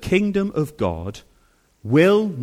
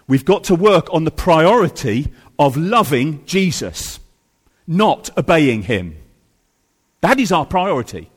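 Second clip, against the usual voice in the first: loud, higher preaching voice driving the point home; full-force preaching; 6 semitones higher, 4 dB louder than usual. Acted. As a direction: preach it with rising passion.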